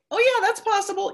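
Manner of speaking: high, offhand mock shrug; mild shrug. Speaker mimics half-hearted belief, high and offhand. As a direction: toss it off as a maybe.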